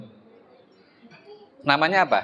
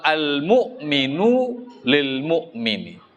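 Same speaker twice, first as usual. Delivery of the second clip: slow Arabic recitation; much slower than usual. Acted. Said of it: "perumpamaan di hadis ini".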